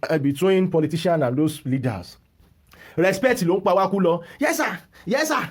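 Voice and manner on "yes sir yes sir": high-pitched imitation of an obedient follower; mock deference. Mimicking people too respectful to leaders, high mock-deferential voice. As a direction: say it like a yes-man, pitched right up.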